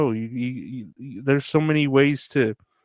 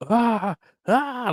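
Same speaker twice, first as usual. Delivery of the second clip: high pained cry; 11 semitones higher than usual. Retold or imitated. Imitating burned customer scream.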